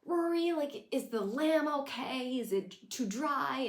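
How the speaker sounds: sugary mock hostess, slow at the end; imitating Emily. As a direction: fuss sweetly over the granddaughter. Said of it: "And she's like".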